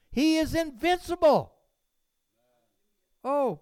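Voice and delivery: loud, high preaching climb; fiery preaching. Acted. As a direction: preach it out to the congregation. Preaching at full force.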